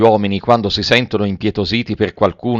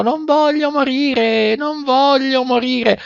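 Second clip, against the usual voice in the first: very high, drawn-out cry of a puppet; desperate wail; 14 semitones higher, slower than usual. A narrator's reading. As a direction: plead for your life in a long high wail.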